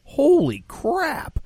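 high, slow, drawn-out holy crap; stunned exclamation. Real, not acted. Podcast host recalling the comic's filthy stand-up, drawn-out amazed exclamation. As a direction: pitch jumps way up in disbelief.

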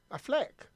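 "Affleck" with a short e, as Aff-leck; corrected pronunciation. Shown as a demonstration. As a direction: say "Affleck" with leck rhyming with neck. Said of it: "what did you call him?".

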